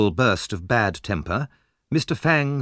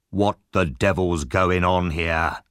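slow, low, rough man's demand; a big brute silencing the room; deep voice; 4 semitones lower, slower than usual. A narrator's reading. The big man at the door, slow and low. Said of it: "a deep voice".

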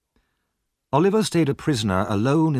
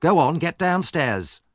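cross; 6 semitones higher than usual. A narrator's reading. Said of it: "she said crossly".

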